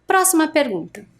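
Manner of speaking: normal talking voice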